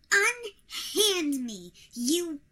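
loud, furious, struggling to break free; angry struggling. Acted. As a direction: fight off the grip, furious. Struggling in the wizard's grip.

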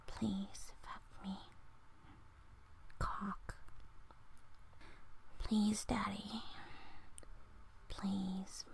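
breathy dirty-talk whisper, close to the mic; ASMR whisper. Acted. Vlogger whispering dirty-talk lines to the viewer, hushed. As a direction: whisper each line like a secret in the ear.

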